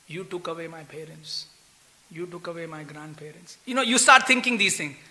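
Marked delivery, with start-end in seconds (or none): 0.1-3.6 hushed resentful thought. Retold or imitated. Devotee voicing a resentful thought.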